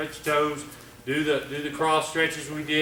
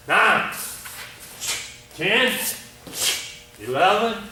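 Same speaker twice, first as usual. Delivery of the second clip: counting kata; 4 semitones higher, much slower than usual. Shown as a demonstration. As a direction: call each count as you do the move. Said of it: "I'm gonna count it".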